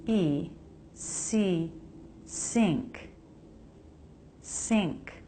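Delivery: "sink" with the vowel closer to E, tongue back for the N G; "sink" said with a tight, near-E vowel. Shown as a demonstration. Teacher modelling "sink" for learners, tight vowel. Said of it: "It's closer to E".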